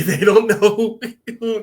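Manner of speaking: cracking up mid-sentence; laughing. Real, not acted. Host amused recapping the fight scene to co-host, laughing.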